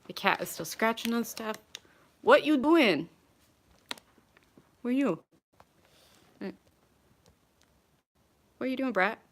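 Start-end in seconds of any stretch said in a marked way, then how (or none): 2.2-9.2 higher, louder pet-talk; talking to cat. Real, not acted. To her cat scratching stuff, in playful pet-talk. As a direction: coo and scold the cat playfully.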